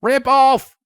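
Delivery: loud jeering heckle; jeering shout. A narrator's reading. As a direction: heckle from the hill. Jeering from the hill.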